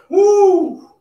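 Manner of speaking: high loud whoop; big whoop after the set; excited whoop. Real, not acted.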